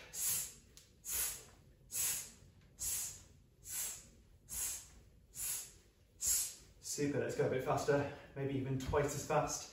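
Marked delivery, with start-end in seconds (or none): 0.1-6.6 soft, steady snake-like hiss; short soft hisses in rhythm. Shown as a demonstration. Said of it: "Could we make it a hiss sound?".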